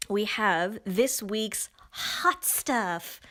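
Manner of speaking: slow, drawn-out mock announcer. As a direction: present it like a slow game-show host. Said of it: "I don't know why I said it like that".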